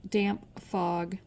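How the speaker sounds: ordinary delivery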